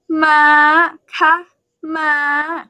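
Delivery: ma held high at the top of the range. Shown as a demonstration. Thai teacher showing the high tone to a student, high and held. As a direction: pitch ma up high and stay there. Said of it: "So high is".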